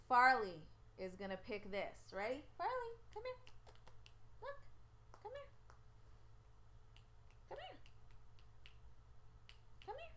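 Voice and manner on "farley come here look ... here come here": very high, coaxing pet-call voice. Real, not acted. Owner calling stubborn Farley to make a pick, high coaxing. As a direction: coax the pet over in a high squeak.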